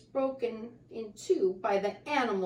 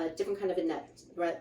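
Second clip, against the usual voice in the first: ordinary delivery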